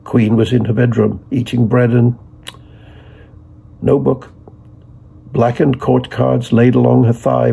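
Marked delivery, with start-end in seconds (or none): none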